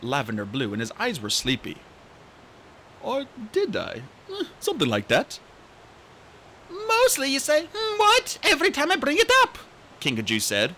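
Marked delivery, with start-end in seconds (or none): 3.0-5.4 a hint of a dozy dragonette. Coconut's sleepy voice. 6.7-9.6 put-on high, bright young dragonette voice; chirpy excited young dragon; eager little RainWing voice; Kinkajou's voice. Kinkajou teasing.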